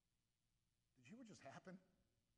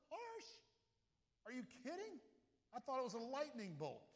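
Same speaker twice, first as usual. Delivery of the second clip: much higher, rising scoff on "harsh?"; incredulous; 8 semitones higher, 5 dB louder than usual. Real, not acted. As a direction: toss the word back in disbelief.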